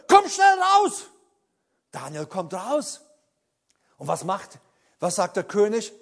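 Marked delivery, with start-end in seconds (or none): 0.1-1.1 high, loud eager call; urgent call. Acted. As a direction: hurry him out, full of relief. King urges Daniel out.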